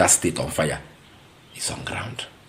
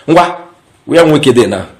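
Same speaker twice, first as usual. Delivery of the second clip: excited call-out; 9 semitones higher, 13 dB louder, slower than usual. Real, not acted. Host calls viewers to attention.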